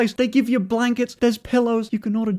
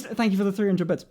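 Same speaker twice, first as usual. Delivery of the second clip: ordinary talk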